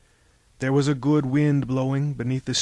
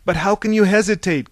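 higher, louder urgent cry; cried out; 6 semitones higher, 6 dB louder than usual. A narrator's reading. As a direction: call out in disbelief to a friend. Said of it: "cried Pylades".

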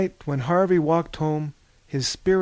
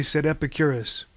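ordinary delivery, nothing marked

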